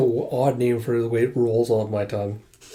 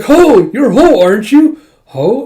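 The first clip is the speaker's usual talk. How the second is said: much higher and much louder squeal; high, loud delighted cry; overjoyed squeal; 9 semitones higher, 10 dB louder than usual. Acted. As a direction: squeal with delight at finding him. Overjoyed at finding the squirrel.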